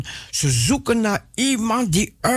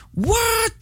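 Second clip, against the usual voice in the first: a sudden, much higher squeak of amazement; mock astonishment. Preacher to his viewers, feigning amazement at solved problems. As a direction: act stunned by the miracle.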